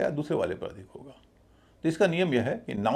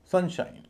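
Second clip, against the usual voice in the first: सन higher, shine lighter; stress on सन; 7 semitones higher, slower than usual. Shown as a demonstration. Teacher modelling a compound noun, stress on सन. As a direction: lift सन and relax shine. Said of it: "सन पर थोड़ा अधिक stress है".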